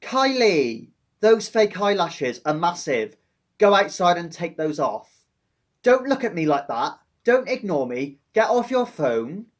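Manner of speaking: teacher barking at a pupil on her phone; stern teacher telling off. Acted. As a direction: tell off a cheeky pupil. Teacher scolding pupil roleplay.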